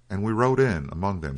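ordinary delivery

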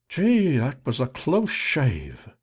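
a guard letting out his breath after a scare; relieved watchman muttering. A narrator's reading. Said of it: "the man muttered to himself".

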